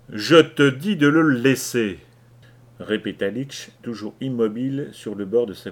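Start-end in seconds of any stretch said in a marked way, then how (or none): 0.1-2.0 metallic voice. Acted. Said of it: "sa voix prenait une sonorité métallique".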